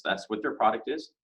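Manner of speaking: ordinary delivery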